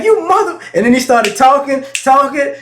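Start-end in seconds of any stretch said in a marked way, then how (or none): 0.0-0.6 high angry curse. Retold or imitated. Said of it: "he'd be like".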